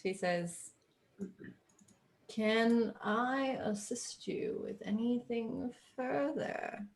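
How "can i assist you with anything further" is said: unvoiced, breathy hush; hushed mystic whisper. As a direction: breathe it like a secret. GM voicing fortune teller.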